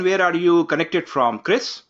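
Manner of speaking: normal talking voice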